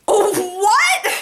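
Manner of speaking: high, laughing "what?"; stunned and cracking up; baffled laugh. Retold or imitated. Reacting to the old man's joke with a baffled laugh. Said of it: "I'm like".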